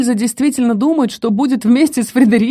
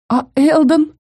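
tearful exclamation; 8 semitones higher, slower than usual. A narrator's reading. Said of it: "плачущим голосом воскликнула я".

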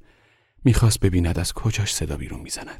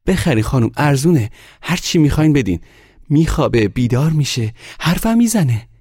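put-on high, loud child hawker pleading; insistent sales plea; 11 semitones higher, 8 dB louder than usual. A narrator's reading. Boy trails the woman urging her to buy, loud pleading. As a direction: press the buyer in a raised, high voice.

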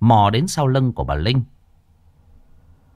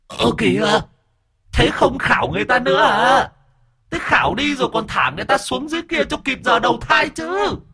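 much higher whining protest; wailing ghost; 14 semitones higher than usual. A narrator's reading. Ghost wailing at the departing exorcists, much higher. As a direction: whine after them as they leave. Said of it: "Con vong mồm gào lên".

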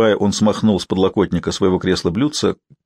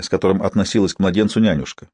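usual speaking voice

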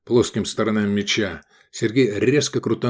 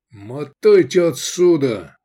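loud, drawn-out raised-pitch yell; shout; 5 semitones higher, 5 dB louder, slower than usual. A narrator's reading. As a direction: yell at them to clear off. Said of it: "крикнул Лобанов". (громкий протяжный крик)

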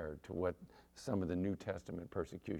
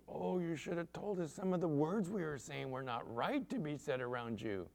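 higher, imitated embarrassed apology; lightly acted dismay, pitched up; mock apologetic; 6 semitones higher, 4 dB quieter than usual. Man learning he swore near a pastor, apologetic. Apologetic fisherman's reaction retold.

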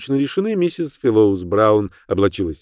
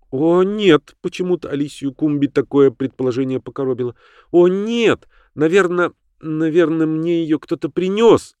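offended denial; 7 semitones higher than usual. Acted.

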